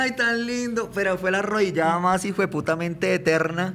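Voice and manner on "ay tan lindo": high mock cooing; imitating onlookers swooning at a proposal; imitated adoring onlookers. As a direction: gush sweetly like the crowd, pitched up.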